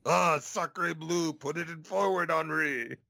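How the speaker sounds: a bad French soldier voice; French accent. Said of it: "Oh, that was horrible. You sounded more Canadian".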